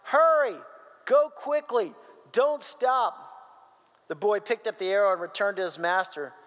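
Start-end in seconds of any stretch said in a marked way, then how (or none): 0.0-3.1 raised, higher narrated shout; urgent separate bursts, pitched up. A narrator's reading. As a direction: shout orders after a runner, controlled. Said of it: "Then he shouted".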